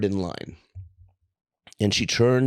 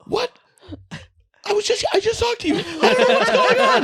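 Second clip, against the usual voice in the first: high, frantic, fast panicked voice; high squeaky freak-out; panicked woman; 3 dB louder, faster than usual. Retold or imitated. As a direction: play the woman losing her mind. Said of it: "this chick starts losing her mind".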